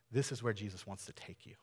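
hushed, confiding whisper; whispered emphasis. Real, not acted. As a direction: drop to a whisper to drive it home. Pastor hushes to stress point.

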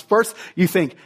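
ordinary talk, nothing marked

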